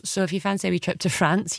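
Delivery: ordinary talk, nothing marked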